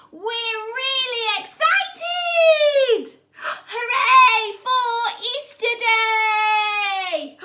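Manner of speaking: slow, high, loud joyful cry; excited cheer. Acted. Teacher cheering the Easter refrain for children, slow and loud. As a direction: cheer it out, stretched.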